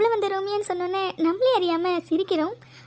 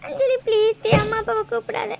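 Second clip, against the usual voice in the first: breaking into tears while pleading; sobbing plea. Acted. Begging a friend not to call the parents, sobbing. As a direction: beg through sobs. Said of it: "ஏன்டி இப்படி தேம்பி தேம்பி அழுதுட்டு இருக்க".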